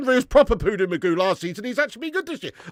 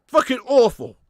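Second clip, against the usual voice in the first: usual speaking voice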